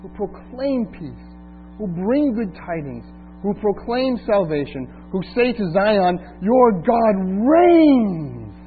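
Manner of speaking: proclaiming good news from hush to shout; building scripture reading. Acted. Reads Isaiah building to climax.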